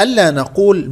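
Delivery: usual speaking voice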